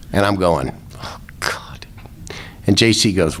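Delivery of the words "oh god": breathless whisper of dread; a hushed, sinking "oh God". Retold or imitated. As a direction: whisper it like you're about to get caught. Dread muttered under a police search.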